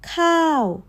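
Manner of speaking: khao jumps high then drops off a cliff; falling tone. Shown as a demonstration. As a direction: start high and fall sharply.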